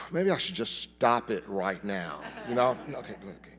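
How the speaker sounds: ordinary talk, nothing marked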